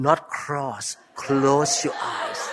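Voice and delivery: soft, slow correction murmured into an ear; American doctor friend whispering the fix into his ear, slow and soft; mock whisper. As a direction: quietly coach a friend, drawing out the words. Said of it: "whisper to my ear".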